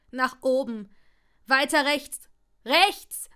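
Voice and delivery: loud orders climbing in pitch; urgent shouting. A narrator's reading. Draco steering Longbottom's aim at the spider, shouting urgently.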